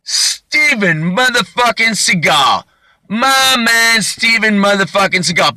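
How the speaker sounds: high, slow, drawn-out shout of recognition; starstruck fan. Retold or imitated. Suspect on the ground recognizing the action star, slow high starstruck holler. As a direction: stretch each word in loud, high delight. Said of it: "he looks up and he goes".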